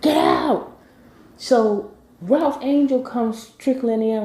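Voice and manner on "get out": high-pitched angry order, acted out; going off. Darla throwing out the uninvited guests, acted out angrily. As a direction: imitate someone throwing people out, pitch climbing. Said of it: "Darla went off".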